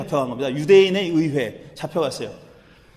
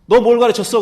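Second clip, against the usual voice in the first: loud, raised demand of an interrogator; interrogating; 4 semitones higher, 8 dB louder than usual. Acted. High priest questioning the prisoner, interrogating. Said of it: "신문하는 사람한테".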